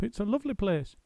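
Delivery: high, chattering monkey; a monkey's quick squeaky voice; Muggle Wump. A narrator's reading. Muggle Wump questioning the roly-poly bird.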